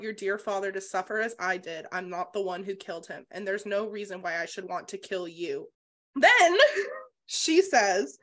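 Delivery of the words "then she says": laughing. Real, not acted. Laughing at the twist.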